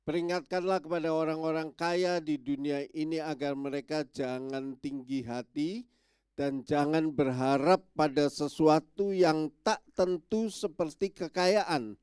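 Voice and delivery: ordinary talk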